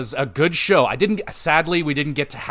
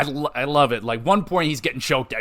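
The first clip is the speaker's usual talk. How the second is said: ordinary talk, nothing marked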